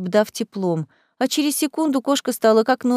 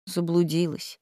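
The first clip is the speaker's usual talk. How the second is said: softer, on a sigh; 4 semitones lower, 7 dB quieter than usual. Acted. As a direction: sigh the word out. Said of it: "вздохнула она".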